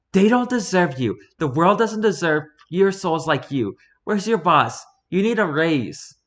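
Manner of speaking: high, loud gushing praise; excited. Pretend. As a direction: gush with overflowing gratitude. Overjoyed praise of barista.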